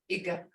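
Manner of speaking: "Iga" soft and brief, no held tone; short, toneless vowel. Shown as a demonstration. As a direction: cut "Iga" short and quiet. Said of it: "It don't have a tone".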